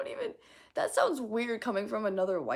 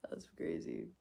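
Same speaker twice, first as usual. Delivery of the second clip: whispered under her breath; breathy whisper to herself; 9 dB quieter than usual. Real, not acted. Whispered aside.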